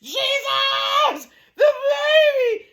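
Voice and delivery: high slow crying shout; hollering Jesus. Retold or imitated. Mother retelling her panic as baby falls, high crying shout. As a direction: wail it out in panic. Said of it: "you holler Jesus first".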